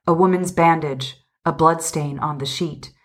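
ordinary delivery, nothing marked

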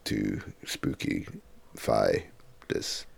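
put-on low, slow spooky voice. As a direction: drop deep and soft to sound spooky. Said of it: "lower voice, as you can hear".

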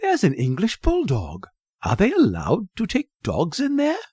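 high, eager questioning; excited boy. A narrator's reading. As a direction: pipe up keenly, pitch climbing at the end. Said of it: "he cried".

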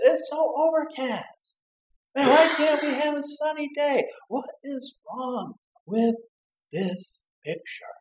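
high, slow whiny moaning; grumpy complaining. Acted. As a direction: moan about the grey sky. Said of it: "You say".

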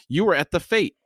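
high, sudden outburst; startled, high-pitched exclamation; blurted out. A narrator's reading. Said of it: "Crocus blurted out".

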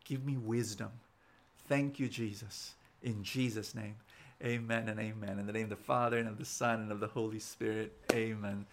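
low, softened praying voice; prayer. Real, not acted. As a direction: pray gently, pitched below normal talk. Leads closing prayer.

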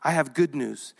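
soft, low and gentle; quiet reassuring angel; tender reassurance. Acted. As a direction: comfort them like a frightened child. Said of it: "But he says".

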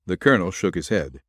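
ordinary delivery, nothing marked

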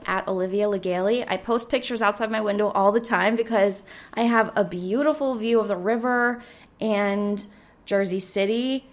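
usual speaking voice

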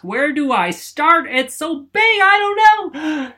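high, loud, near-crying wail of panic; overwhelmed panic. Retold or imitated. Host acting out his own overwhelm, mock panicked wail. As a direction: spiral into hammed-up overwhelm. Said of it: "Like, "Oh my gosh".

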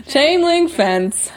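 drawn out, eerie storyteller; slow spooky reading. Acted. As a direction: stretch each word for atmosphere. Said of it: "I'm trying to add to the atmosphere".

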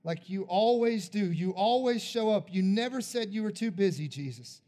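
a pastor's altar-call prayer, swelling; fervent prayer. Acted. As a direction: pray over them with building conviction. Closing prayer over the people.

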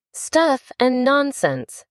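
high, slow, indignant exclamation; drawn-out protest without a real shout; loud protest. A narrator's reading. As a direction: dismiss it firmly, pitched well up. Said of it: "said Alice loudly".

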